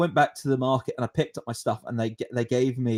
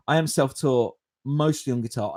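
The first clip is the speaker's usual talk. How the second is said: ordinary talk, nothing marked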